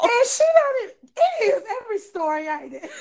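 high, loud laughing cry; cracking up mid-sentence; laughing shout. Real, not acted. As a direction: shriek with laughter at the joke. Laughing over the wall joke.

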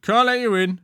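high, loud guard echo; second guard chiming in. A narrator's reading. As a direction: echo the refusal loudly. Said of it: "chimed the second".

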